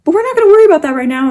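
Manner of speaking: very fast, high and loud; loud high-speed rallying, coming down at the end; rushed brush-off. Real, not acted. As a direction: barrel past the problem at full tilt.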